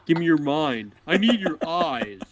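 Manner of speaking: high, strained creepy demand; creepy robber voice. Acted. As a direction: leer and plead in a squeezed high voice. Mask robber gag voice.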